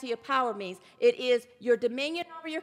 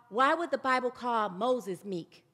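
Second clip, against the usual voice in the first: ordinary delivery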